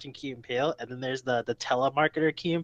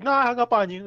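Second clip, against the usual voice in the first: high, louder mock retort; lightly hammed-up comeback, pitched up; voiced sketch character; 8 semitones higher, 5 dB louder than usual. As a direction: act out bluntly setting a caller straight. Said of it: "It's like".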